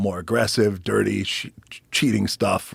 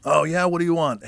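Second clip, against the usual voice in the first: gruff wrestling boss; 9 semitones higher than usual. Retold or imitated. Pat Patterson gruff on phone.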